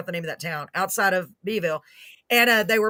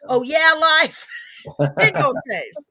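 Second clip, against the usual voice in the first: defiant taunt; 5 semitones higher, 5 dB louder than usual. Pretend. Defying life, laughing.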